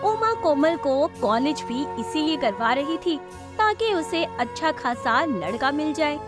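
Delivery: ordinary delivery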